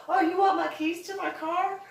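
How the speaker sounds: high and loud, playing dumb; high mock astonishment; mock surprise. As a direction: act amazed they want the keys. Mock surprised reply to police.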